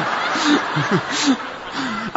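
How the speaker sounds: laughter. Real, not acted. Laughing after the story.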